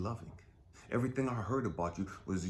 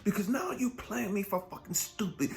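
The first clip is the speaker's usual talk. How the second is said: furious; 9 semitones higher than usual. Real, not acted. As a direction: rage at God, pitch climbing. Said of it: "I'm pissed even more now".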